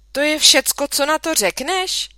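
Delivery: high, loud indignant challenge; wife confronting husband about the tadpole. A narrator's reading. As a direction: demand her husband take her side. Mother indignant at father.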